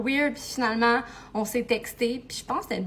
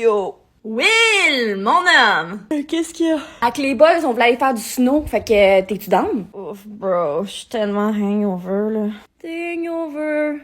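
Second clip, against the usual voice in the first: bro voice; 3 semitones lower, 7 dB louder, slower than usual. Acted. Guy-friend role, loud bro talk.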